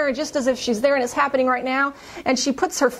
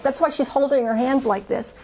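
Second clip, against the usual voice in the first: ordinary talk, nothing marked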